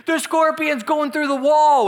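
high, loud, slow mock-panic cry; panicked yell. Imitating the panicked roommate next door, mock-terrified yell. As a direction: wail it out in hammed-up alarm. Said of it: "all I hear next is".

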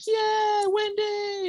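high cheering crowd voice. Retold or imitated. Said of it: "everyone's like".

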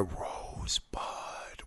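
drawn-out breathy whisper; mock-dramatic movie whisper; hushed whisper. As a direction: whisper it like a mysterious last word. Quotes a famous dying whisper.

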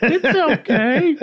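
loud, very high, slow falsetto; voice goes up. Retold or imitated. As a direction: drag it out high, nearly laughing. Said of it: "her, her voice goes up".

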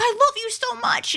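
high, drawn-out weepy fan imitation; mock tearful fan. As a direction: imitate an overwhelmed fan gushing through tears. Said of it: "in tears".

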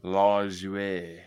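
"Lajoie" stretched out as LAAHZH-way; demonstrated pronunciation. Shown as a demonstration. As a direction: give "Lajoie" a long first vowel and "way" ending. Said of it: "Lajoie the right way".

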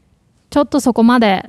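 fast, brisk Japanese exchange; 早口の会話例; quick example dialogue. Shown as a demonstration. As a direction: rattle off the question and answer quickly. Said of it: "let's just do an example".

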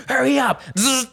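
high-pitched imitated command; impatient guard hurrying people along, acted; barked order. Costumed worker rushing the last one into the truck, barked order.

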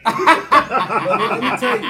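high, slow, laughing sales pitch; laughing mock pitch. Job seeker laughing while pitching himself to recruiters. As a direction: stretch it out through laughter. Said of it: "I be like".

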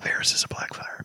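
hushed whisper, almost no voice; spoiler whisper. Real, not acted. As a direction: drop to a secretive whisper. Whispered aside about Varys.

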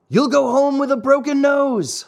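high-pitched schoolboy threat; shrieked threat. Acted. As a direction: threaten him, voice climbing high and staying there. Boy threatens over mocking cuckoos.

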